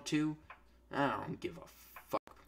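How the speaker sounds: ordinary talk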